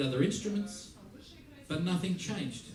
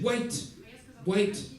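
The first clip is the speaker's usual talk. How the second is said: urgent call; 6 semitones higher than usual. Retold or imitated. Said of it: "And I said".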